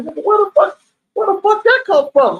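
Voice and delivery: high, urgent questioning; alarmed demand. Retold or imitated. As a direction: demand answers in panic, pitched high. Demanding where blood comes from.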